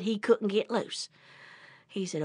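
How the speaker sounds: usual speaking voice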